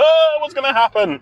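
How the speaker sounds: high, dramatic gasped question; hammed-up anxious anticipation; mock suspense. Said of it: "There was no big sort of".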